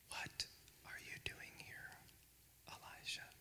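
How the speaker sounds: faint whisper, barely audible; still small voice. Acted. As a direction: whisper like a voice at your ear. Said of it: "And a voice said".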